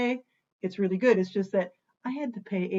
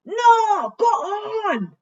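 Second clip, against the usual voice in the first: high cheering shout; 11 semitones higher, 8 dB louder, much slower than usual. Pretend. Cheers himself on loudly.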